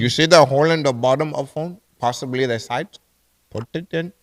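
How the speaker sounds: put-on Indian-accented helpdesk instructions; mock Indian accent. Agent guiding caller to plug in, mock Indian accent. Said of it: "I can do the Indian voice".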